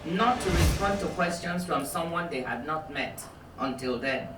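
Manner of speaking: calm, even reading from a memoir; measured author reading; book reading. Acted. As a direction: read the passage steadily to the audience. Reads aloud from her book.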